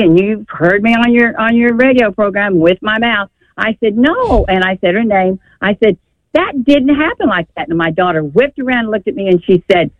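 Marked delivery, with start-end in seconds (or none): 4.0-4.5 sharp, high "No" from a grandmother; a mock-raised objection, pitched up; retold yell. Grandmother contradicting her granddaughter's lie, retold raised voice. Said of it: "Mother, don't yell at my daughter". 6.3-7.7 higher, insistent correction; retold raised voice. Grandmother correcting the story in front of her daughter, retold raised voice. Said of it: "Mother, don't yell at my daughter".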